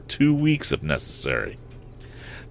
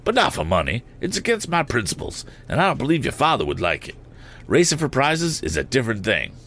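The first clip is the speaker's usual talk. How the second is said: lightly voiced airman, pitched up; aeronaut character voice; 6 semitones higher than usual. A narrator's reading. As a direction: lightly act a balloonist settling a challenge.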